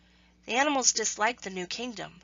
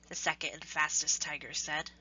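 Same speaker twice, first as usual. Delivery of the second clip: ordinary delivery, nothing marked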